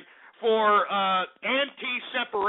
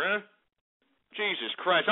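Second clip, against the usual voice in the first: usual speaking voice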